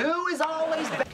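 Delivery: squeaky-high repeat of the catchphrase; falsetto-like high delivery; high-pitched. Acted. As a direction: send the whole phrase way up high.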